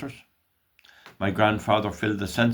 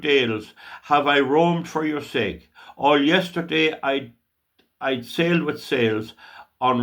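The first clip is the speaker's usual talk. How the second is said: impassioned, higher and louder declamation, often slow; poem recitation; 4 semitones higher, 4 dB louder than usual. Acted. Reciting a poem about Ireland to listeners, fervent declamation.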